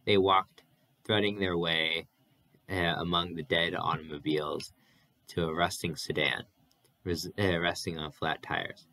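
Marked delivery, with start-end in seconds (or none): none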